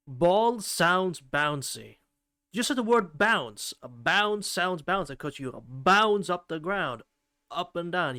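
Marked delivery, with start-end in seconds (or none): 0.1-2.0 slow, drawn-out stress on "Ball" and "bouncy"; emphasized BALL, BOUNCY. Shown as a demonstration. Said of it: "I'm emphasizing the word a little bit". 3.8-7.0 slow, with "bounce" stretched and punched; emphasis on BOUNCE. Shown as a demonstration. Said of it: "I'm emphasizing the word a little bit".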